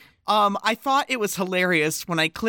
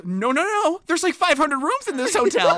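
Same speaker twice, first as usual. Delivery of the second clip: high, protesting comic retort; Kathy Griffin; 10 semitones higher than usual. Retold or imitated. Comedian turning down the drunk actor, mock protest. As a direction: fend it off in a raised, quick protest. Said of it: "And Kathy goes".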